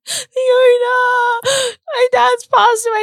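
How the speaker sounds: high, slow, breaking voice; distraught news. Retold or imitated. High, breaking voice telling the therapist her dad has died. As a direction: voice cracking as she says it. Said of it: "I'm like".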